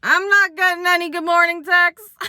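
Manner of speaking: high, loud petty chime-in; comic jab; deadpan complaint. Retold or imitated. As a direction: sneak in a jealous jab. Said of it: "I chimed in".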